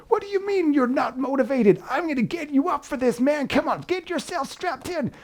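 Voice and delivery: American gym trainer barking you into the rower; shouty American PT. Retold or imitated. American PT accent, coach pushing the viewer to get strapped in. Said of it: "that was my impression ... shouty American P T type".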